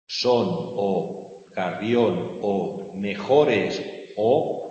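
loud, slow stress on every "O" in the words, one by one; hitting each vowel. Shown as a demonstration. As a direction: punch out each "O" for the class. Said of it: "Esto es lo que va a determinar".

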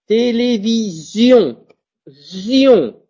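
télévision with sion as zion, drawn out; télévision avec un Z; slow demo. Shown as a demonstration. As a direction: buzz the z in télévision. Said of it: "je prononce comme un Z".

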